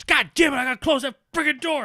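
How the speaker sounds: high, loud grumbling wrestler; annoyed Batista voice. Retold or imitated. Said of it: "and he's like".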